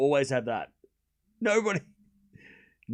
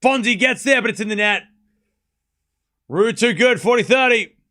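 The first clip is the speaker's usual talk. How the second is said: rising, much louder excited tennis call; excited commentator voice, higher and louder; live play-by-play; 7 semitones higher, 7 dB louder than usual. Acted. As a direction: call the rally as it happens, building up.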